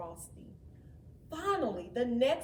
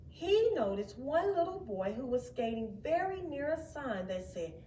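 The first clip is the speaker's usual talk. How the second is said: usual speaking voice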